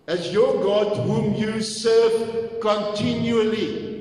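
"serve continually" slowed and stretched out; highlighted phrase. Shown as a demonstration. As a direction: dwell on "whom you serve continually" to drive it home. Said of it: "Let me just highlight this".

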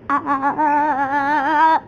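breathless laughing ahs. Real, not acted. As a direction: laugh it out in short bursts. Laughing while playing.

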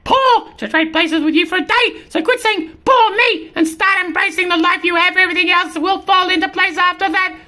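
high squirrel sermon. Acted. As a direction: preach at the cat, high and urgent. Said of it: "This is the squirrel talking now".

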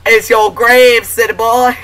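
loud, high Texan taunt; Texan accent. Pretend. Gamer mocking enemies who wandered in, Texan accent. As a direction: jeer in a put-on Texan voice.

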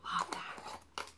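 hushed delight; whispered approval. Real, not acted. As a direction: breathe out your approval. Admiring the candle.

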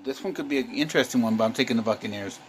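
usual speaking voice

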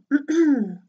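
confident preparatory throat clear; short, loud acted "ahem". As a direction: clear the throat before a big attempt. Dragon stepping up confidently.

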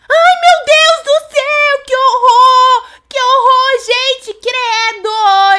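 loud, high, drawn-out horrified wail; screaming in horror. Real, not acted. Sees something horrible.

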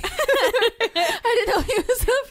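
slow high laughing words. Real, not acted. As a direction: laugh through the line. Laughing after scare.

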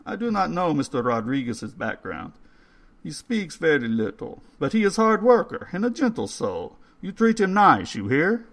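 put-on coaxing old man spooning soup. A narrator's reading. Old man's voice, held.

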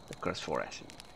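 breathy whispered title. Acted. Title repeated at intro end.

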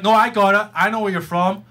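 ordinary talk, nothing marked

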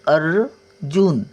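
अर्जुन stretched out slowly, the र heard after अ; teacher drawing attention to how अर्जुन is spoken; slow demonstration. Shown as a demonstration. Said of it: "बोलण्याकडे लक्ष द्या".

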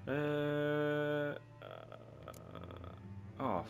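usual speaking voice

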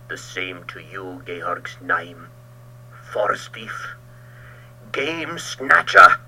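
slow, bitter, building insult. Acted. Ulrich returning Georg's curse, slow bitter sneer. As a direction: sneer the names at him slowly.

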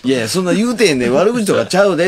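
high, loud fast denial; quick protest. Retold or imitated. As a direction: rush to deny it. Protesting to Arii.

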